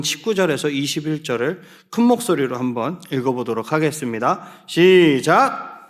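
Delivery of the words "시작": drawn-out count-in call; long, projected cue to begin; group reading cue. Real, not acted. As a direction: stretch it out to start everyone together. Cueing congregation to read aloud.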